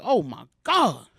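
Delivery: hammed-up dread as the gang crosses over; drawn-out mock fear. Scared reaction in joke.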